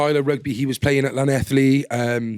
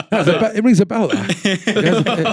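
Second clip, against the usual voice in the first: laughing; 4 semitones higher, slower than usual. Real, not acted. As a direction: crack up while getting the words out. Laughing at his own joke.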